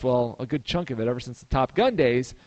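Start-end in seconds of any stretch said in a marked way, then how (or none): none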